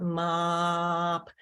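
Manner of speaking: slow segmented mop; stretched out. Shown as a demonstration. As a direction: draw the word out slowly, sound by sound. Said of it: "stretch it out".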